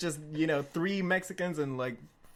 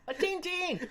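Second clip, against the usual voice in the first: put-on much higher babbling drunk voice; slurred drunk; 13 semitones higher than usual. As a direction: babble like a wasted friend. Wasted Chuck babbling.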